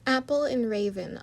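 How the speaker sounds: usual speaking voice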